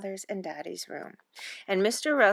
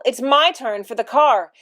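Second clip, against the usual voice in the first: louder, higher claim; insistent; 4 semitones higher, 10 dB louder than usual. Acted. Mother argues with father over the car, insistent. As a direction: stake your claim loudly.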